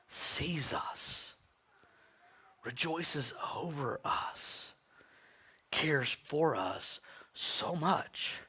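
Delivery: hushed, very slow whisper; slow tender whisper of the Father's care. Real, not acted. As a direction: lean in and breathe each phrase. Tender sermon climax.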